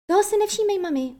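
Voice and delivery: lightly smiling, indulgent reassurance to mum; indulgent smile. A narrator's reading. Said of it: "usmála se Wendy schovívavě". (schovívavě, s úsměvem)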